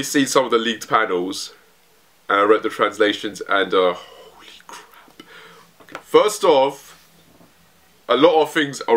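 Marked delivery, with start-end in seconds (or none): 3.9-5.2 slow awed whisper; whispered in awe. Real, not acted. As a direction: breathe it out, stunned. Stunned by the leaked spoilers.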